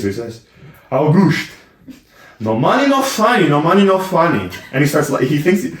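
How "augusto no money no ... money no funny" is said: higher-pitched imitation of a Portuguese accent. Retelling the handyman's favourite catchphrase to a co-host, Portuguese accent. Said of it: "He says it in, like, an accent".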